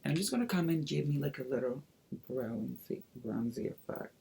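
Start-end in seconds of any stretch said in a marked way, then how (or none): none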